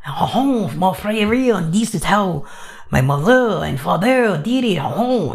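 put-on rough, theatrical French accent; bad French accent. Pepe telling his backstory, bad French accent. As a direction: tell a tale in a fake French accent. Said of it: "I don't have a good French accent voice yet".

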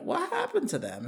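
high-pitched mock bafflement; puzzled disbelief. Voicing fans of a vanished actor, high puzzled disbelief. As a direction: voice jumps up in acted puzzlement. Acted reaction to actor's disappearance.

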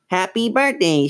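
high squeaky puppet greeting; Slappy. A narrator's reading. Dummy greets a party, high puppet voice. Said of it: "she made Slappy declare".

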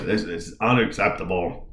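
lightly imitated gruff voice; a half-worked gruff politician impression; Gavin Newsom. Host imitates the governor blaming the utility, lightly gruff. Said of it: "in his gruff voice".

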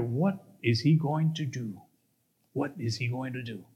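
low, soft suspenseful question; hushed wondering. Acted. Friends watching the angry man, hushed wondering. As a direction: wonder under your breath, letting it drop. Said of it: "they wondered".

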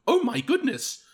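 high, lightly mocking gasp of an old lady; gasping mother. Grazia's mother in the back, mildly mocked gasp. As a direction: clutch your pearls, half-joking. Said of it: "and she goes".

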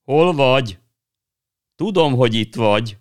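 high, loud searching call. Acted. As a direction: shout into the darkness for her. Calling for sister in dark.

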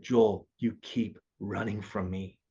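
Jesus's words retold low and soft; quoted gentle whisper. Jesus whispering to him in the vision, softly. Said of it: "then he whispered in my ear".

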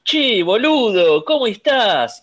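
overdone Spanish attempt at Argentine slang; mock Spaniard imitating Argentine. Mocking Spaniards who imitate Argentines, in a Spanish accent. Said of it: "Ibai o cualquier español que te quiere imitar al argentino dice".

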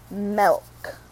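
"milk" said with an E, like "melk"; hometown pronunciation. Shown as a demonstration. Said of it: "M E L K is literally how it's pronounced".

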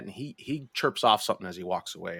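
normal talking voice

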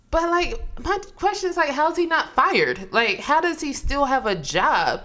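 high, rising indignant questioning; real incredulity. Real, not acted. Host questioning how Kyrie keeps his job, incredulous. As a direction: argue in disbelief, pitched well up.

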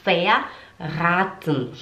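verraten slowly, A lengthened; long A. Shown as a demonstration. Teacher shows the long word, slow verraten with held A. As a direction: draw out the A of verraten. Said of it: "o A, ele é longo".

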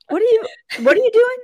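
high, fast, incredulous; shocked disbelief. Retold or imitated. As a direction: squeal it in disbelief. Retelling her shocked reply.

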